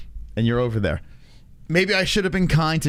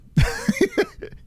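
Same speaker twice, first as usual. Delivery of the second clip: very high short giggle; tickled high laugh at the prank. Real, not acted. Laughing at humbled fighter.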